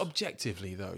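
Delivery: ordinary talk, nothing marked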